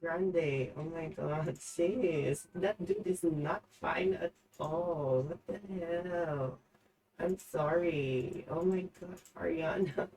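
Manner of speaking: ranting under her breath; trying to calm down. Real, not acted. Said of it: "I gotta calm down for a second".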